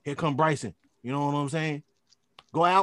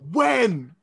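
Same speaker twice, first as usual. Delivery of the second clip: mock coach shout; 6 semitones higher, 8 dB louder than usual. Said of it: "He's like".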